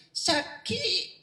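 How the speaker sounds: Padang man says sick. Retold or imitated. Padang man telling the Sundanese he is sick, light Minang accent. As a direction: say "Saki" as a Padang man, lifting high. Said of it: "Orang Padang ngomong lagi".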